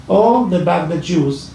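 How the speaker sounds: ordinary delivery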